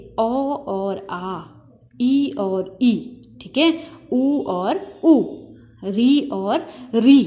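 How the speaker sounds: drawn-out vowel pairs with long pauses; slow vowel pairs. Shown as a demonstration. Teacher to students, slowly demonstrating matching vowels. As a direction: lay each pair out slowly for the class. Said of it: "आपस में सवर्ण हैं".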